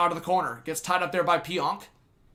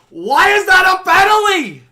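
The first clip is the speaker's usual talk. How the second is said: much higher and louder, shouting in disbelief; outraged shout; 15 dB louder than usual. Real, not acted. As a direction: yell it at the referee. Outraged at the referee's call.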